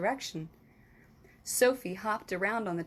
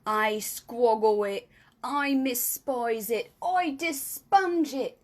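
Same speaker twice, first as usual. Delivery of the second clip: slow, louder, building disgust; disgusted rant; 5 dB louder, slower than usual. Acted. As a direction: giant ranting in disgust. Giant rants at vegetable.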